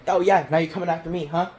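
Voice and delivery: fast, loud, high taunt; taunting comeback. Retold or imitated. Talking back to the kid yelling rude things, fast loud taunt. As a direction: throw the challenge right back at him. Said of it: "and I was like".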